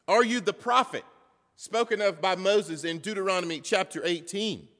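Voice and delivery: high, loud questioning; pressing interrogation. Acted. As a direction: challenge him for an answer. Acting out priests questioning John.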